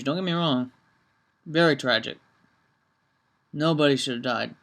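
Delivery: talking around a candy cane; candy in the mouth; mouth full. Real, not acted. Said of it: "Oh, excuse me, I got candy cane back in my mouth".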